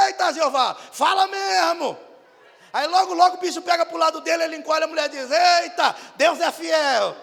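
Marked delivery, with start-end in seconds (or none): 0.1-2.0 high, loud, slowed shout of amen; husband shouting. Retold or imitated. Husband shouting agreement in church, loud and slow. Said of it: "o marido grita". 5.3-7.2 high, loud, drawn-out cry; loud church exclamation. Retold or imitated. Wife crying out in church, loud and slowed.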